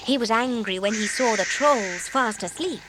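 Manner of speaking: ordinary delivery, nothing marked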